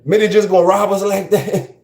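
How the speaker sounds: high-pitched imitation of an indignant girl; mock outraged girl, pitched well up; imitating upset girl. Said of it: "look what that girl said".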